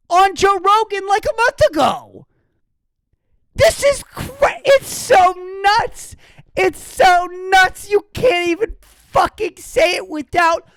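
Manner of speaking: high, loud exasperated rant; high-pitched incredulous tirade. Real, not acted. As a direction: rant in disbelief, voice way up. Ranting at full pitch.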